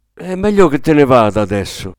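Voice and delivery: a firm, loud dismissal; exclaimed loudly. A narrator's reading. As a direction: say it loud enough to be heard downstairs. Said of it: "esclamò infine, tanto forte".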